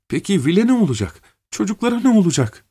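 desperate high-pitched questions; pleading in rising panic. Acted. Desperate mother asking about the children's fate. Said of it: "çaresizlik içinde".

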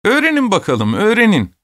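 high-pitched taunting call; jeering shout. A narrator's reading. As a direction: dare someone mockingly, calling out. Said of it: "diye bağırarak gülüştüler".

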